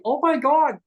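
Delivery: high, loud amazed outburst; astonished. Retold or imitated. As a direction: exclaim it in shocked disbelief. Acting out the stunned caller's thought.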